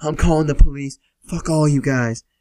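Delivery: high, loud angry threat; imitated screaming. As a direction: mock-scream at the whole room. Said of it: "then he starts screaming".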